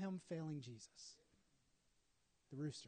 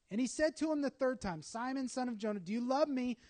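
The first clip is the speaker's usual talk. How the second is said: Jesus pressing the question, louder and higher; louder, higher asking; 5 semitones higher, 7 dB louder than usual. Acted. Jesus asking Peter a second time, louder and higher.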